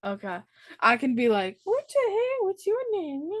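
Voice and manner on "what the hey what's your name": very high little greeting voice; cooing greeting. Pretend. Host imagines meeting a new puppy, squeaky high greeting.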